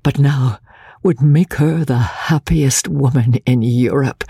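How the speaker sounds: ordinary delivery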